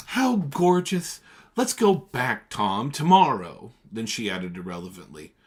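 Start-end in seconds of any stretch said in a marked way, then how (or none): 0.1-3.7 high, loud, slow delight; thrilled gush. A narrator's reading. Daisy begs Tom to go back, thrilled. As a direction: squeal with joy.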